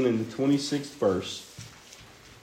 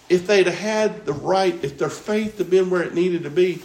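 ordinary delivery

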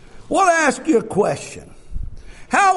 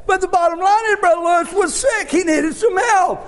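full-force preaching; 9 semitones higher than usual. Acted. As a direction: drive it home to the congregation. Preacher builds again.

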